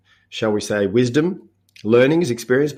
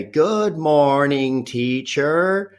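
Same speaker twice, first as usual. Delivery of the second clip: slow sing-song class chant; children chanting to their teacher in unison; kids in unison; 5 semitones higher, much slower than usual. Retold or imitated. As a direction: drawl it out like a room of five-year-olds. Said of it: "they'd all say".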